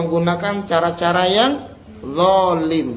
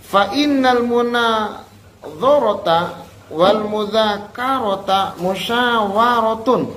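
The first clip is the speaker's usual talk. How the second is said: slow chanted Arabic line; Arabic recitation; 3 semitones higher, slower than usual. Acted. Teacher intoning Arabic text to the class. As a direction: intone it slowly and solemnly.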